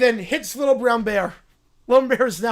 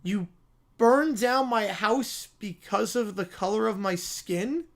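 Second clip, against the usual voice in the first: ordinary delivery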